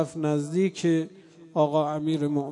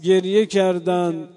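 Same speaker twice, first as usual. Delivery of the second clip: loud mourning sermon at full force, half sung; impassioned preaching; 6 dB louder than usual. Acted. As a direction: cry it out to the mourners in the dark.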